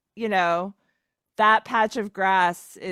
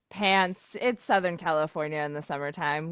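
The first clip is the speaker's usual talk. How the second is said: normal talking voice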